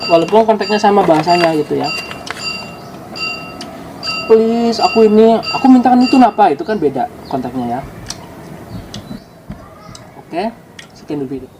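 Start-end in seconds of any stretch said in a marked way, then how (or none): 4.3-6.5 higher, imploring plea; beg, pitched up and earnest; pleading. Retold or imitated. Showing viewers how begging sounds, higher pleading voice. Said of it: "memaksa dan memohon itu beda".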